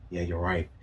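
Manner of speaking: ordinary delivery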